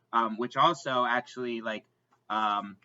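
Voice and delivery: ordinary delivery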